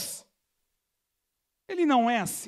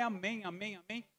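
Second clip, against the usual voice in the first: mumbled amens; 3 semitones lower, 11 dB quieter than usual. Retold or imitated. Mumbling amen along with friend.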